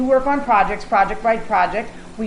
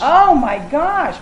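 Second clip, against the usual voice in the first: excited; 6 semitones higher, 5 dB louder, much slower than usual. Real, not acted. Moved by the guest's answer.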